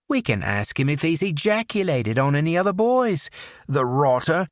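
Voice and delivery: mocking English accent. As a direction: sneer politely in an English accent. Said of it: "lapsed into his English accent".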